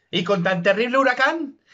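ordinary talk

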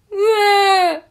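high, loud cartoon bawl; exaggerated anime crying noise; mock anime wail. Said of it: "an anime person cries".

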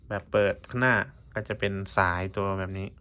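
ordinary talk

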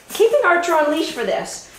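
ordinary delivery

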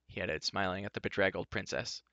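plain reading voice

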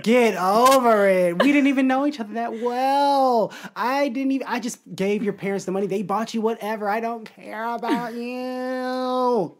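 slow, drawn-out comic scolding; hammed-up slow, dismissive grievance; irritated great-grandma. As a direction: play a fed-up great-grandma brushing someone off. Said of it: "my great grandma will be like".

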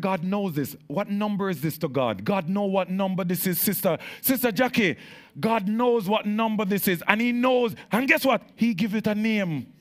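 fervent preacher, loud and high; preaching at full force. Acted. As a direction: preach it to the congregation, building.

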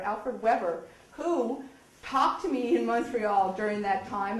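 usual speaking voice

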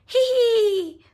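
high, loud hee-hee giggle; giggling. A narrator's reading. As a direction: giggle like a delighted toddler. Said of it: "giggled George".

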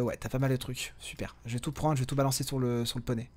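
soft whisper while looting. Real, not acted. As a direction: murmur it under the breath. Looting quietly.

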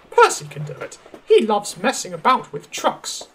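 high-pitched, fussy prima donna voice; haughty lady diesel. Acted. Daisy turns down the engine shed, fussy and haughty. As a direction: complain grandly in a high voice.

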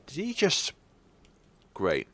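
ordinary talk

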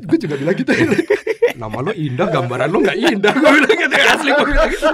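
laughing through the words, high and loud. Real, not acted. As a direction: crack up while retelling. Laughing at his joke. (tertawa sambil bercerita)

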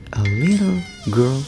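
plain reading voice

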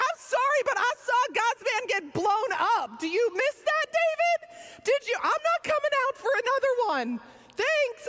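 a scared crowd tells David no with sass; indignant refusal. Retold or imitated. People refusing the second parade.

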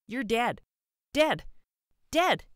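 high, loud staccato shouts; child shouting. A narrator's reading. Boy snapping toy pistol at George, shouting. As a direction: fire each word off like a toy gun.

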